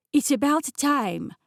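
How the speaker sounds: put-on impatient French chef accent. A narrator's reading. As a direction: scold latecomers as a French chef. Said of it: "in an unmistakably French accent".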